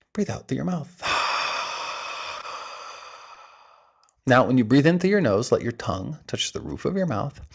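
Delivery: soft, hushed breathing-coach voice with long pauses; guided breathwork. Acted. Coach counts the listeners through breath holds, soft and hushed. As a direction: guide them gently through each hold. Leads a timed breath exercise.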